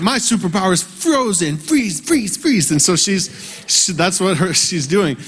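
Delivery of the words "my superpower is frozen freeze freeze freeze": put-on high girlish chant of freeze; little girl voice. Father imitating his daughter as Elsa, high girlish chant. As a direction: chant the spell like a small girl. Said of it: "she's like".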